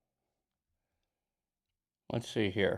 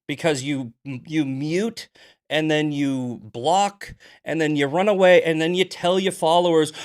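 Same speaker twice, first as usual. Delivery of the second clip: loud, higher angry listing; heated accusation; 3 semitones higher, 5 dB louder than usual. Real, not acted. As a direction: build the accusation, louder. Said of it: "I'm heated today".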